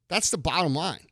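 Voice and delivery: ordinary talk, nothing marked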